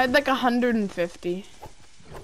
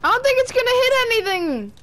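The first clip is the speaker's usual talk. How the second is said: excited; 8 semitones higher, faster than usual. Real, not acted. Repeat, pitched up.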